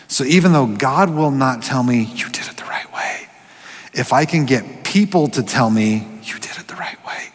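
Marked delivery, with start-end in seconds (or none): 2.0-3.3 very soft, unvoiced whisper; whispered approval. God withholding approval from the preacher, whispered. 6.2-7.3 hushed whisper, barely there; whispered approval. Acted. People telling the preacher what he longs to hear, whispered.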